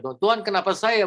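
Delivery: high, loud mock lament of "Tuhan, Tuhan, kenapa saya?"; mock wail. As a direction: imitate a self-pitying cry to God, pitched high. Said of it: "bukan bilang".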